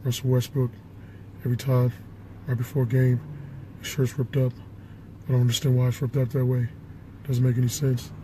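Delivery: low, quiet big-man mumble; Shaq. Acted. Co-host agreeing with Barkley about Westbrook, low soft Shaq voice. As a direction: drop it low and soft, short phrases with pauses. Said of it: "And then Shaq".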